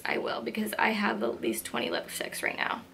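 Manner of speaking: soft unvoiced whisper; whispered aside. Real, not acted. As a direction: drop to a secretive whisper.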